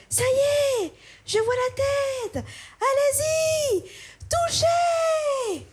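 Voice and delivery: high-pitched eager midwife, pushing her to touch; midwife urging. Retold or imitated. Midwife urging the new mother to touch the baby's head, high and eager. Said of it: "elles font".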